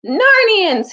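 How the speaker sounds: put-on nervous young king calling the assembly; proclamation. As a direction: open a royal speech, projecting. King begins speech.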